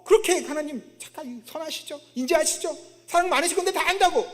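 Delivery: acted jeering complaint; sneering sarcasm. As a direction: taunt God with sarcastic praise. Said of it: "비아냥거리는 거예요".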